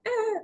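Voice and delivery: short high-pitched "ah" of alarm; mock panicked squeal. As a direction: squeal as if jolted by adrenaline. Acting out an adrenaline rush.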